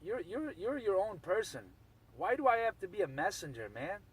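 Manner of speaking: ordinary talk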